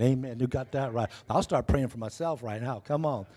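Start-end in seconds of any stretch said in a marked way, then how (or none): none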